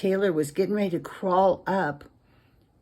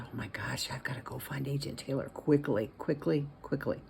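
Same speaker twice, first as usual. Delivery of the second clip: hushed urgent thought; 3 semitones lower, 6 dB quieter than usual. A narrator's reading. Hiding in vent, thinking anxiously.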